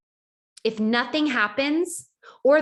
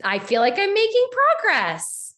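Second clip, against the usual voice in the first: mock cheerful; 7 semitones higher than usual. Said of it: "I'm like".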